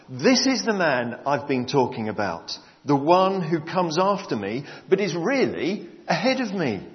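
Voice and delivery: loud, high, full-voiced Bible reading; preaching scripture reading. Preacher reads John's testimony from the Message, loud and proclaiming. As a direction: proclaim the passage to the whole church.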